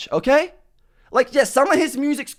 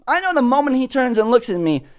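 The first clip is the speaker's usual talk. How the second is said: ordinary talk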